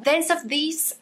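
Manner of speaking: clumsy French-accented English; English title in a rotten, Flemish-sounding accent; bad English accent. Real, not acted. Book vlogger attempting an English title, bad accent. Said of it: "avec mon accent pourri".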